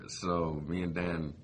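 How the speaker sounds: normal talking voice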